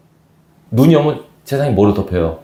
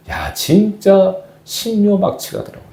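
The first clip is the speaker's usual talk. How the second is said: much higher, slow marvelling; amazed; 10 semitones higher, slower than usual. Real, not acted. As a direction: draw out real wonder. Marvelling at deep-sea fish.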